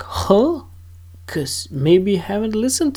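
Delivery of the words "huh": sudden high puzzled huh; a baffled listener's high huh; confused huh. Retold or imitated. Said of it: "You probably went like".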